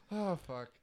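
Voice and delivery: ordinary delivery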